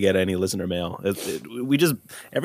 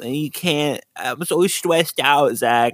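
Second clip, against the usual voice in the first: slow babyish imitation of complaining; mocking whine; 2 semitones higher, slower than usual. Co-host imitating Jonah being too stressed, mocking whine. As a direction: whimper it in mock self-pity, dragging the words.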